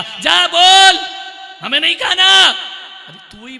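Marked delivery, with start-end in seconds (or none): 0.0-1.1 high, long-drawn holler; shouted order. Acted. Mother ordering her son to go tell papa, yelling. 1.6-2.5 high, loud sulky refusal; angry shout. Acted. Father refusing dinner in a quarrel, shouting back angrily.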